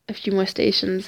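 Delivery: ordinary talk, nothing marked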